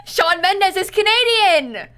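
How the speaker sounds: high, loud, drawn-out exclamation; excited outburst. Real, not acted. Friend realising the singer fits the joke, excited outburst. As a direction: cry it out slowly through leftover laughter.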